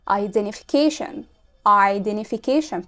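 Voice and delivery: slow "Identification" with no T; dropped T. Shown as a demonstration. Teacher drilling "Identification" slowly without T. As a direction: stretch "Identification" slowly, T silent. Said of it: "طب و identification".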